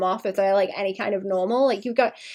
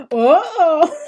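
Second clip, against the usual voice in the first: laughing uh-oh; 7 semitones higher, 7 dB louder than usual. Shown as a demonstration. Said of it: "That was the wrong intonation for that".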